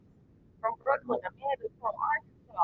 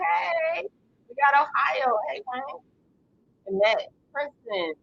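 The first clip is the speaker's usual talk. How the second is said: ordinary talk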